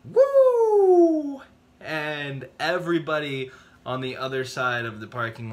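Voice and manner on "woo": very high, loud, drawn-out "woo"; whoop. Retold or imitated. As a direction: let out a big whoop. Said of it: "I was like".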